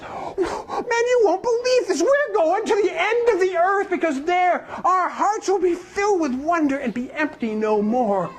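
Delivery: put-on high, slow little girl full of awe; little girl's voice. Little girl telling the young man the news, high and awed. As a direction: tell it like a wide-eyed child.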